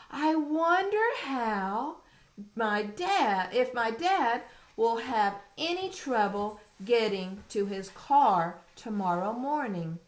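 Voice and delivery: high, slow wondering read; reading to a toddler. Acted. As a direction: wonder aloud like a child. Reading picture book aloud.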